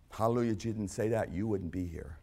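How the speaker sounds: ordinary talk, nothing marked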